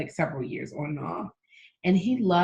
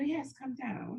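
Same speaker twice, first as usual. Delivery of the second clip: put-on higher, softer British accent; 6 semitones higher, 11 dB quieter, slower than usual. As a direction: put on British for a tea-time reply. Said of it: "I do a great British accent".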